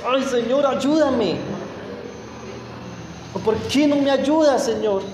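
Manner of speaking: quejido agudo, como reclamando a Dios; súbelo y quéjate como un niño mimado; tonito quejumbroso. Retold or imitated. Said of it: "hasta con un tonito de". (tonito quejumbroso y agudo reclamándole a Dios)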